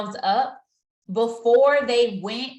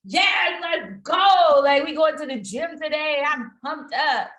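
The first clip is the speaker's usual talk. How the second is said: high, loud hype shout; pumped up; 6 semitones higher, 5 dB louder than usual. Retold or imitated. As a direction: shout it like you're fired up. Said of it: "God touches me and he'd be like".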